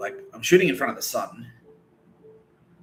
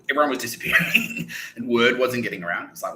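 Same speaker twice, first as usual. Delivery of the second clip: normal talking voice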